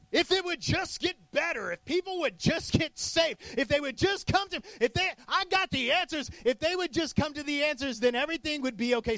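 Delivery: high, pleading wishful voice; wishful praying. Retold or imitated. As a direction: whine it up as if begging God to fix things. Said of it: "We're like".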